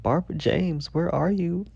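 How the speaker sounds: high, mock-tearful call; imitated crying. Crying owner searches for her cat, mock-tearful calling. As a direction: call the lost cat as if starting to cry. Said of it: "I start crying".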